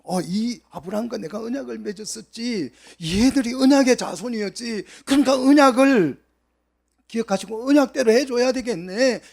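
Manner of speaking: God recalling His covenant, then deciding; voicing God. God's musing voiced in sermon. (하나님이 혼잣말하듯 점점 높고 크게)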